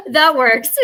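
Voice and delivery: bursts of high laughter; laughing. Real, not acted. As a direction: laugh through the words. Laughing at her own explanation.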